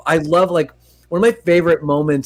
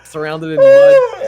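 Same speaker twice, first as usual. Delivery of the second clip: much higher and louder, slow and stretched; mock dramatic; 7 dB louder, slower than usual. Retelling the movie to a friend, hammed-up wail.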